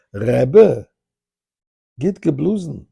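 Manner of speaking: high, emphatic Polish Yiddish; Polish Yiddish accent. Retold or imitated. Guard surprising the rabbi after the shofar, Polish Yiddish accent. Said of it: "Polish Yiddish accent".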